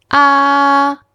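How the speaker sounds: ah held high and level; "ah" long, high, unwavering; high flat tone. Shown as a demonstration. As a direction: sustain "ah" on one high note. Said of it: "high and flat tone".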